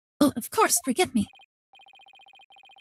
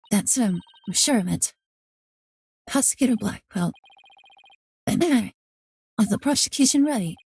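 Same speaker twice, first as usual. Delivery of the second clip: ordinary delivery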